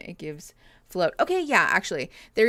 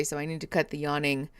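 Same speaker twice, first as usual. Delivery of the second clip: ordinary talk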